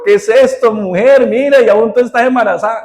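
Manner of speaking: a husband confronting his wife in a joke, mock outrage; husband's outrage. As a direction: play the stunned husband catching his wife. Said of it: "le dice".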